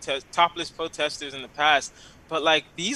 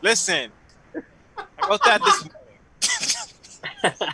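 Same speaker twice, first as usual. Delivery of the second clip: imagined friend correcting; 4 semitones higher, 5 dB louder than usual. Retold or imitated. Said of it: "Chris's head was like".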